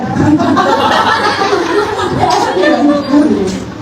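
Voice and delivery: helpless laugh at his own attempt; real burst of laughter. Real, not acted. Laughing at himself in front of the congregation, cracking up.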